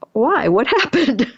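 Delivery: high, feigned-casual question with a laugh; tentative asking. Retold or imitated. Cautious texted reply, acted out.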